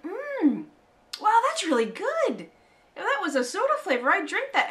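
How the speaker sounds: high, loud, delighted; excited. Retold or imitated. Own delighted reaction to cough syrup.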